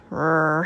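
low mock wolf growl; low rolled growl. A narrator's reading. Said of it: "he growled".

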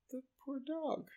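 high, soft pitying sigh. Retold or imitated. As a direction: pity the dog, high and hushed. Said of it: "I'm like".

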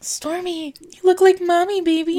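lightly voiced Kylie Jenner. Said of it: "Kylie Jenner to come in your room and say".

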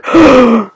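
sharp startled intake of breath; mock gasp. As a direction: gasp like you just got caught. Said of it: "and we're all like".

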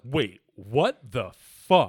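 slow, loud, stunned disbelief; loud, dragged-out astonishment; dumbfounded. Retold or imitated. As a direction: draw each word out, baffled. Acting out astonished reaction to interview.

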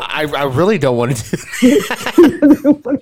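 laughing. Real, not acted. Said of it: "laughing refusal".

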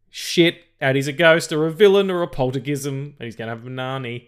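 high, loud mock alarm then fast; mock panic. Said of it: "it's like".

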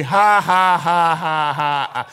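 loud mock laugh that runs down; demonstrated forced laugh. Shown as a demonstration. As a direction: laugh deliberately in the face of trouble. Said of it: "you are laughing all over".

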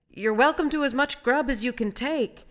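loud, high, generous offer; calling out. A narrator's reading. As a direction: call it heartily over the rail.

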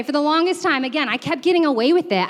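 ordinary talk

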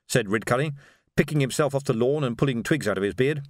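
plain reading voice

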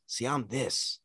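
quiet, brooding champion boast; Roman impression. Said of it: "Roman does the".

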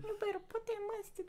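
very high, soft squeaky voice; mock baby voice. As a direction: play a tiny demanding baby. Same talking baby bit.

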